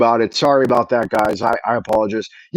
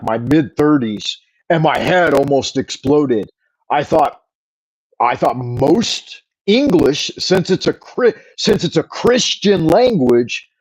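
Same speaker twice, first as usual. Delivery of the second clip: ordinary talk, nothing marked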